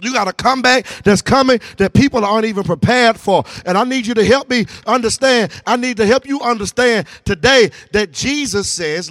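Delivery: forceful sermon with punched key words; fired-up preaching. Real, not acted. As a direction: preach it hard, rousing the congregation.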